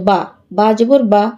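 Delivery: quick বা with no stretch; brief, unheld vowel on বা; short vowel. Shown as a demonstration. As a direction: say each বা short. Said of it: "আলিফটা না থাকলে কিন্তু ঠিক এরকমের উচ্চারণ হতো".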